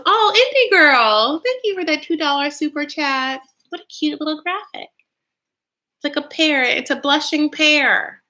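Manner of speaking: high-pitched happy gushing; delighted. Real, not acted. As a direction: gush over a cute gift from a viewer. Delighted thanks for a super chat.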